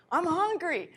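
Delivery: high voiced dog; high pretend dog voice. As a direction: speak as the hungry dog, high. Voicing the dog.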